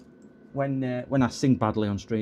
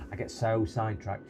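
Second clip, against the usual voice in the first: usual speaking voice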